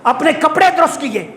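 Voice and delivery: high preaching rising into a long-drawn call; sermon crescendo ending on a stretched, high call; rousing preaching. Real, not acted. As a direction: build up, then cry the name out long.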